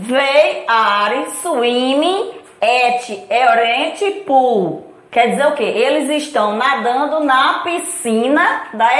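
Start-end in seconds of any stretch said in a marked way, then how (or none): 0.1-4.9 slow model sentence. Shown as a demonstration. Teacher reading the example sentence to students, slow and careful. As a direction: read out slowly, word by word.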